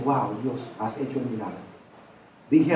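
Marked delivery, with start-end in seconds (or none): 0.0-1.6 awed hush. Retold or imitated. Retold awe at healing.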